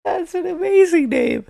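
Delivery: very high, squeaky voice cracking up; amused squeal. Real, not acted. Sports vlogger tickled by River Crackraft's name, amused high squeal. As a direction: squeak it out after a laugh.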